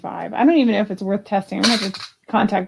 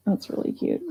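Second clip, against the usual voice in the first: hushed private aside, no voice; whispered admiration; 8 dB quieter than usual. Real, not acted. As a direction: whisper it to yourself. Repeats the praise under her breath.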